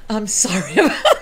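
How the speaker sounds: apologizing with a laugh; laughing apology. Real, not acted. Laughs after dog interruption.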